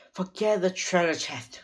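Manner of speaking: high, loud urging; urgent. A narrator's reading. Girl pulling her brother from treasure, urgent. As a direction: urge them to drop it, pitched high. Sister urges brother to leave.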